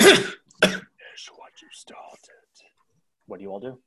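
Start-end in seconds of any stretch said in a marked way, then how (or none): 0.0-2.2 whisper. Shown as a demonstration. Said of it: "repeats the whisper".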